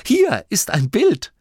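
high, loud, slow announcement; begeistert. A narrator's reading. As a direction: present the find triumphantly. Boy shows the book's picture. (hoch und laut, voller Eifer)

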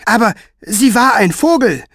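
put-on high, loud child's wonder; amazed boy. A narrator's reading. Jacopo telling Staubfinger she was a bird, high and amazed. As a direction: blurt out the marvel.

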